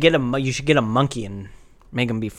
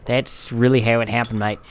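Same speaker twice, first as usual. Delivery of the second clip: Australian wildlife presenter spoof; Jack Wallaby, Australian accent. Acted. Presenting his horse dog to the host, Australian accent. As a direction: play an Australian animal showman. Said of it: "pretty good fucking Australian accent".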